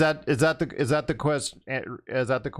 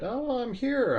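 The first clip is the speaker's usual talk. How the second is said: high-pitched quote; 12 semitones higher, slower than usual. Retold or imitated. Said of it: "I just said".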